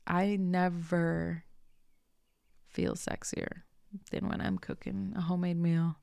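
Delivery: normal talking voice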